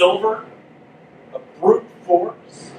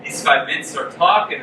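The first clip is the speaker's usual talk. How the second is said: usual speaking voice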